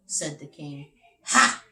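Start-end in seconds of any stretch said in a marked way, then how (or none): 1.3-1.6 triumphant ha. Acted. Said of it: "said Big Max".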